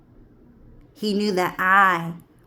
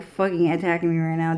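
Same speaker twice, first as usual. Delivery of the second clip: normal talking voice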